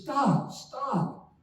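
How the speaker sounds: lightly imitating a patient yelling stop; retelling how the patient called after her car; mock yell. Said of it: "he starts screaming at me".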